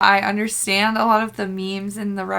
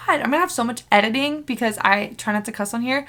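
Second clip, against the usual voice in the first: usual speaking voice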